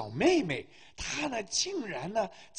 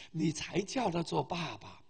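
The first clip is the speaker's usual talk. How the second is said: ordinary talk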